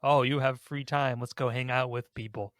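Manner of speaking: normal talking voice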